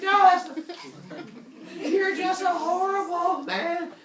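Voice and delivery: very loud, high mock-furious screech; yelling. Woman yells at Zeus while running, shrieked insult. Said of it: "they're yelling back and forth".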